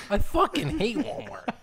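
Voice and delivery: laughing. Real, not acted. Laughing reply about Walmart.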